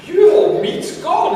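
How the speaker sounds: high, slow, sing-song hostess voice; delighted host. Retold or imitated. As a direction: coo it like a proud host. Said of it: "the host looks over at your table and goes".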